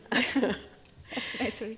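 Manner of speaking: bashful laughing thanks. Real, not acted. Touched by audience, laughing.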